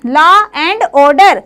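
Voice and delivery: law and order stretched out very slowly; slow, deliberate teacher repeat of law and order. Shown as a demonstration. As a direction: drag out law and order word by word so it sticks.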